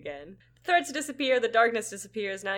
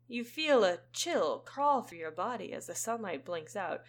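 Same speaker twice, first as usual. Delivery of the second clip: normal talking voice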